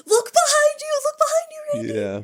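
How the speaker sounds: loud, high-pitched mock scream. People in the van screaming at Randy, loud and high. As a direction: shriek a frantic warning at the screen. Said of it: "they're screaming in the van".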